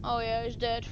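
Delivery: low, very soft and downcast; sad. Pretend. Recalling a companion's brother is dead, sad. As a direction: let it sink to a sorry murmur. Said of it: "I'm really sad".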